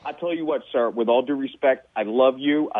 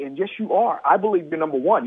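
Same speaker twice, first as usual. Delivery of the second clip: ordinary talk, nothing marked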